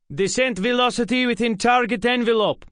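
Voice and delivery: high, loud radio callout; calling out. A narrator's reading. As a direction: call it out crisply over the comms. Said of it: "Anton called out".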